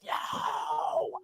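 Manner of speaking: high whispered groan of dread. Retold or imitated. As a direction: breathe out a despairing no. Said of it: "And you're like".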